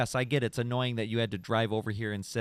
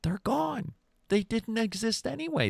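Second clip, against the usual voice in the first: mock eerie high; 10 semitones higher than usual. Joking conspiracy about vanished friends.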